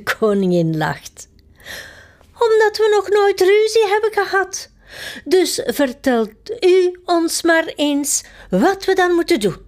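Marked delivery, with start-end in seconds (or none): 2.3-9.6 high queen voice. Acted. Queen asks the footman how to quarrel, high eager voice. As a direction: play the chirpy queen, pitched way up.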